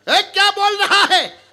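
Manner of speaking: high-pitched, indignant challenge; shocked outburst. Acted. Householder jolted by the visitor's words, shocked outburst. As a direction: react as if jolted by electricity. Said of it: "मानो बिजली का झटका लगा हो".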